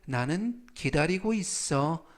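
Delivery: ordinary talk, nothing marked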